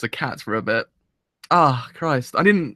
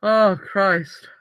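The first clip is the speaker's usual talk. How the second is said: tearful; 5 semitones higher than usual. Real, not acted. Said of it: "I didn't think I was gonna cry".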